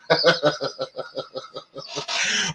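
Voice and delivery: a long, rising laugh at her own joke; amused laughter after a cheeky line; real laugh. Real, not acted. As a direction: let a genuine laugh roll out.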